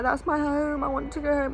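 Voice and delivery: put-on high small voice of a toddler; little child voice. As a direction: whine it like a homesick three-year-old. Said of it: "he's like".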